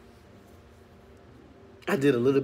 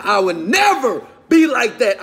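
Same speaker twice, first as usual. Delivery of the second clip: defiant vow; 8 semitones higher, 10 dB louder, slower than usual. Retold or imitated. Vowing not to end up poor.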